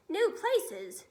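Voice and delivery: high, loud indignant shout; angry officer shouting at the young pilot. Acted. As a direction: bellow it back in disbelief. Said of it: "shouted the head of the Air Force".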